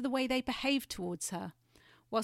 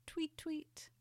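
high soft chirped tweet; swallow's song; 8 semitones higher, 8 dB quieter than usual. Acted. Swallow singing to the fairy-tale writer, chirping high and soft. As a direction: chirp it like a little bird. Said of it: "and the swallow sang".

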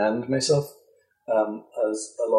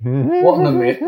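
excited; 8 dB louder than usual. Real, not acted.

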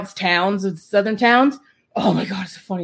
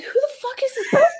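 incredulous shout. Retold or imitated. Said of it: "I was like".

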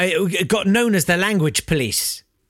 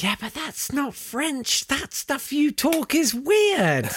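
higher, slow, sniffy Parisian voice; snooty France; 10 semitones higher, 3 dB quieter, slower than usual. Retold or imitated. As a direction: look down your nose at Quebec French. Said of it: "France probably went".

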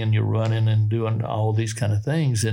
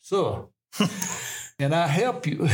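put-on polite young soldier in a light Southern accent; a hint of a Southern accent, courteous; 6 semitones higher than usual. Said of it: "a good Southern accent".

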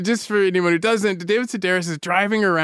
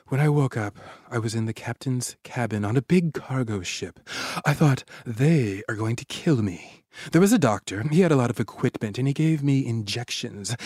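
quoted account reading; 4 semitones lower than usual. A narrator's reading. Host reading her captivity on the ship, subdued narration. Reads the woman's written account.

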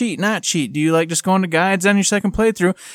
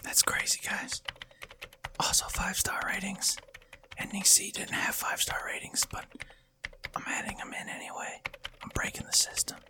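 secretive whispered aside; 17 dB quieter than usual. Pretend. Whispered aside about ratings.

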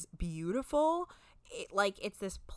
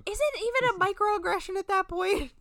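incredulous; 12 semitones higher, 8 dB louder than usual. Real, not acted. Incredulous laughing outburst.

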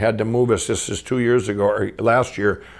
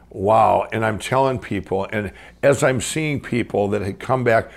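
ordinary delivery

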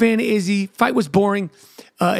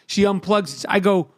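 normal talking voice

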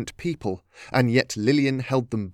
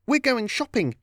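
sudden high-pitched outburst; blurted out; 11 semitones higher than usual. A narrator's reading. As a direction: blurt it out before thinking. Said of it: "Lillian blurted out".

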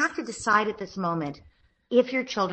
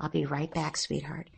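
softer, under the breath; beneath my breath; 3 semitones lower, 8 dB quieter than usual. Shown as a demonstration. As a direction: murmur it just to him. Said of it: "almost beneath my breath".

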